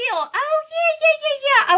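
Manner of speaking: light up as you remember her; excited recognition. Real, not acted. Suddenly recognising a friend named in the chat, excited.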